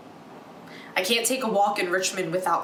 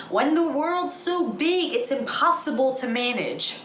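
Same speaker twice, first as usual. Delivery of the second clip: higher-pitched, mock-motherly quoting; mimicking mother; 6 semitones higher than usual. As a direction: imitate mom reciting one of her pet sayings. Daughter mimics her mother's sayings.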